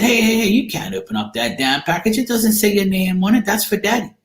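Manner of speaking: high-pitched, insistent telling-off; retold alarm, pitched well above normal; retold scolding. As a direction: act out warning a child off a package. Retold scolding of nephew over package.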